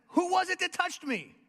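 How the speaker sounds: high, loud searching question; Jesus asking. Acted. Jesus calls out to the pressing crowd, loud and high. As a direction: call out over the crowd. Jesus voiced in retold scene.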